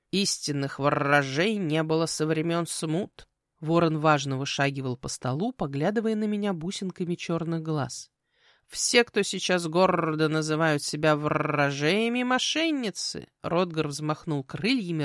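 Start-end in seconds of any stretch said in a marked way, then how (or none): 0.1-3.3 slow lecturing bird with rolled r's; measured raven. Acted. Raven lecturing the girl on fortune-tellers, measured croak. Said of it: "перебила мерно говорившую птицу". 8.7-13.3 slow, indignant raven with rolled r's; measured raven. Acted. Raven lecturing on fake fortune-tellers, slow and pompous. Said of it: "перебила мерно говорившую птицу".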